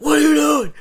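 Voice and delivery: frantic high-pitched scream; loud, high, urgent shout. Retold or imitated. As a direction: yell it in alarm at whoever opens the door. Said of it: "he's screaming".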